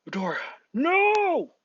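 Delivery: long, high, loud cry of "no"; anguished yell. Acted. All Might loses Izuku in the fog, anguished yell. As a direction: wail it out as the pupil is lost.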